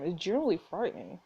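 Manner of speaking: normal talking voice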